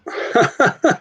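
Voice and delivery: short high laugh; amused laugh. Real, not acted. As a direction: laugh at being found out. Reacting to being placed.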